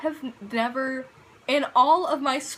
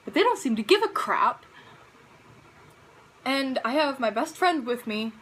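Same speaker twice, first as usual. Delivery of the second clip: ordinary delivery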